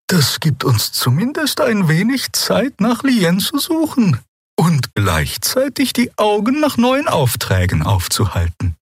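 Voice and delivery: slow, high calming voice; gentle Xea. A narrator's reading. Xea points out they still have time, gentle and slow.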